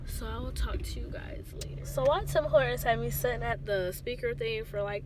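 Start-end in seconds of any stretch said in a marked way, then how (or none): 0.1-1.8 whisper. Real, not acted. Signing off quietly in drive-thru.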